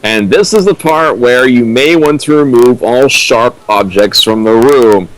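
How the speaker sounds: ordinary talk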